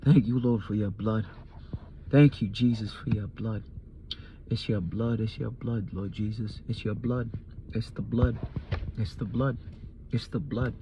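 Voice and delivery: broken, tearful whispered thanks; tearful prayer. Retold or imitated. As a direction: murmur thanks through tears. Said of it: "all I could do was cry".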